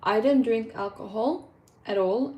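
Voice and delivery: normal talking voice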